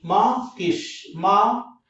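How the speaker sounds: माँ किश slowly, a gap between माँ and किश; slow two-beat माँ, किश; broken into parts. Shown as a demonstration. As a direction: separate माँ from किश each time. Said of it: "words आगे भी तोड़ने हैं".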